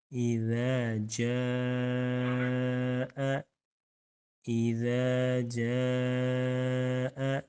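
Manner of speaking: اذا with the ذ held long, tongue tip to teeth; stretched اذا. Shown as a demonstration. Teacher showing how to lengthen اذا. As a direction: draw out اذا slowly. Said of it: "اس کو تھوڑا لمبا کریں گے".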